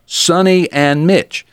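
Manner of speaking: high, slow, drawn out names; DJ announcement. Retold or imitated. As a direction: stretch the names like a DJ. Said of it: "I do the same thing".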